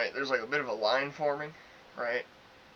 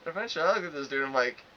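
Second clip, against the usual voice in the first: ordinary talk, nothing marked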